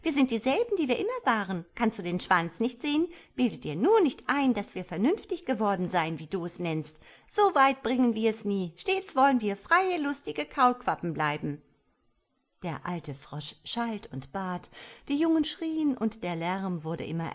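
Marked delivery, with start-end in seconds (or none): 0.0-11.6 higher, louder cheeky frog chorus; defiant young frogs. A narrator's reading. Defiant young frogs taunting the old mother, higher and louder. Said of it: "schrien sie".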